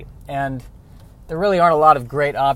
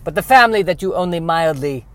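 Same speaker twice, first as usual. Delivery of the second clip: put-on French Canadian accent. Trader justifying the trade.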